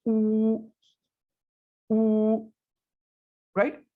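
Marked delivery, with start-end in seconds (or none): 0.1-2.5 teacher modelling the sound ऊ; Chinese wu. Shown as a demonstration. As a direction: round the lips and hold ऊ. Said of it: "कैसे निकलेगी?".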